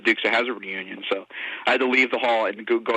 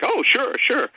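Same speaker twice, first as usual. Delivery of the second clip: much higher, bright imitation of a friendly star; eager agreement; 13 semitones higher, much slower than usual. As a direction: play the star happily agreeing. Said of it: "And he was like".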